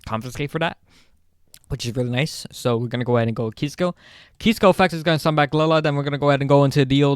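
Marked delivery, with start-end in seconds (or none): 2.5-7.1 fast rattled-off auctioneer patter. Real, not acted. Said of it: "I sound like a auctioneer".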